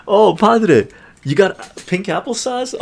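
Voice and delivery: high, bright surprised reaction; excited. Real, not acted. Reacting to viewer's auction win.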